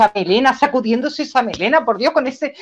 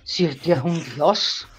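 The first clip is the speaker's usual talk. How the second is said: lower, much softer, slow; a friend's hushed swooning; low awed murmur; 12 semitones lower, 7 dB quieter, slower than usual. Retold or imitated. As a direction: murmur it in reverent awe. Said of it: "me dice".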